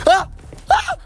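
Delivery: short startled yells; screaming while falling. Real, not acted. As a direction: cry out as you trip and fall. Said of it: "Je suis tombé".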